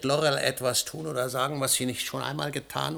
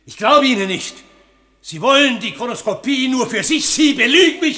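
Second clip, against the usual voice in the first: shouting, louder and higher with each line; furious accusation; 9 semitones higher, 9 dB louder than usual. Acted. Potterley accuses the scientist of lying, furious shouting. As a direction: hurl the accusation at him.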